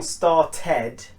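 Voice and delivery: "started" wrongly ending in a full "ted"; wrong ED vowel. Shown as a demonstration. Teacher showing students the mistake, "started" said as star-TED. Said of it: "instead of saying started".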